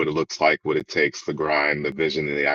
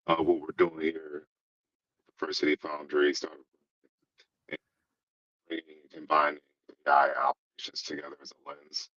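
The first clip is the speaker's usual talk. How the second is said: breaking up over a bad connection; faint, dropping call audio; cutting out; 7 dB quieter than usual. Real, not acted. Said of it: "you're cutting out just a little bit".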